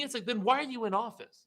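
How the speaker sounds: real exasperation, pitch jumping high; worked-up, indignant challenge; pissed off. Real, not acted. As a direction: demand it, fed up and high-pitched. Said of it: "That pisses me off".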